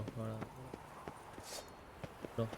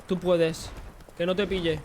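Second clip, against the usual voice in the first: excited cheering; 6 semitones higher than usual. Real, not acted. Cheering character through danger.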